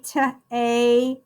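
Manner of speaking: "Ta-able" broken into t, long a, bull; sounded out. Shown as a demonstration. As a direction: sound out Ta-able with pauses between parts. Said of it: "You hear that long A?".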